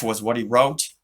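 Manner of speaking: plain reading voice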